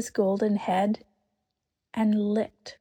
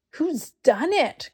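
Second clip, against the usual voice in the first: higher, slow anguished cry; distraught cry; 7 semitones higher, 3 dB louder, slower than usual. A narrator's reading. Susan cries out at the broken table, distraught. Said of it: "cried Susan".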